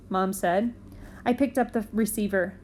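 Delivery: ordinary delivery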